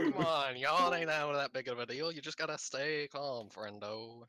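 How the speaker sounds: put-on southern accent. Friend calming the raging player in a southern accent. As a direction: talk him down like a country pal. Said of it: "We started speaking in our southern accents".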